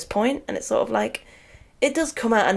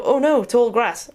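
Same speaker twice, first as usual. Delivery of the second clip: slow, louder, higher hammed-up alarm; mock dramatic dismay; 5 semitones higher, 5 dB louder, slower than usual. Mocking the cartoon kids spotting grass, in hammed-up alarm. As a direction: ham up the kids' fake panic. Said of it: "They go".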